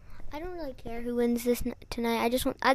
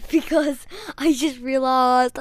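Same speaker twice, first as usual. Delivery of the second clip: slow, louder mock Austrian accent; Schwarzenegger; 6 dB louder, slower than usual. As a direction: explain yourself in a drawn-out Austrian accent. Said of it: "I can sound like Schwarzenegger".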